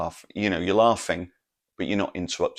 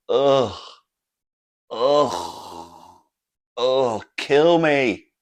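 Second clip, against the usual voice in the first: loud, exaggerated groans of a bored heckler; loud mock groaning; 5 semitones higher, 9 dB louder, much slower than usual. Imitating friend at one bad joke, loud groaning. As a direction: groan loudly as if the act is unbearable. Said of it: "he starts tutting loudly".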